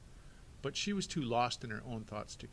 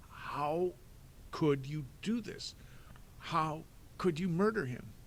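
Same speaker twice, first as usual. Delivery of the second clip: higher, pained accusing voice; anguished accusation; 6 semitones higher, 3 dB louder, slower than usual. Acted. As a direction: demand it through shock. Klaus confronting.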